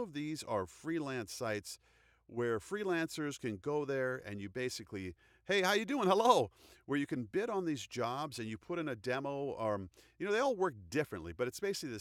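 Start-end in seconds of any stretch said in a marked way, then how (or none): 5.4-6.5 bright, louder, higher sales hello; cheery greeting. Pretend. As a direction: greet the client with big pep. Mock freelancer pitching client.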